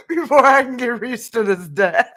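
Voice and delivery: giggles swallowing the ends of phrases; laughing through words. Real, not acted. Telling viewers this deck needs a month, laughing through it. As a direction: push the sentence out through laughter. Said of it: "I spent a week laughing".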